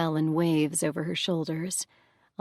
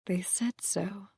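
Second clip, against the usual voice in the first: hushed admission; 2 semitones higher, 6 dB quieter, slower than usual. Acted. Harmonia recalls her attackers.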